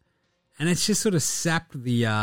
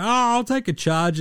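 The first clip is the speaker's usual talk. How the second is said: mock cocky McDaniels; 10 semitones higher than usual. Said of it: "McDaniels like".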